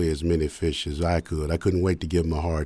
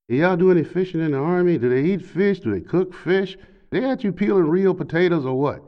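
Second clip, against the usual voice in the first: high, eager tipsy chatter; high excited chatter; 11 semitones higher than usual. Retold or imitated. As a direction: babble on in a raised voice. Drunk stepfather yakking through door.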